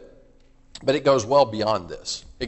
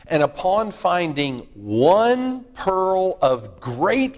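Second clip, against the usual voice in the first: each word stretched very slowly, louder; drawn-out scripture reading; 5 semitones higher, 4 dB louder, much slower than usual. Acted. Preacher reading the parable to the congregation, slow and swelling. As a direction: linger on every word of the parable.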